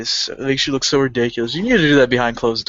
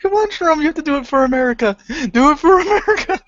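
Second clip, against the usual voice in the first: mock rallying cry; 13 semitones higher, much faster than usual. Mock patriotic rallying, laughing.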